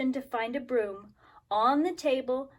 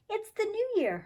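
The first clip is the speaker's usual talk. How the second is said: warning; 10 semitones higher than usual. Acted. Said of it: "her mother warned".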